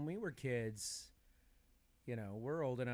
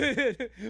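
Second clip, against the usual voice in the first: high squealing laugh; 11 semitones higher, 12 dB louder than usual. Pretend.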